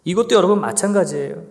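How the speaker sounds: ordinary talk, nothing marked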